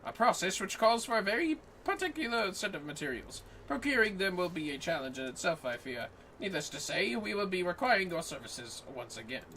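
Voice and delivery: lightly voiced formal scholar, measured and courteous; NPC quest-giver voice. Streamer voicing the quest NPC to her co-op partner, lightly formal. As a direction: read it like a polite old-world sage.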